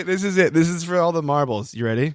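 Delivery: ordinary delivery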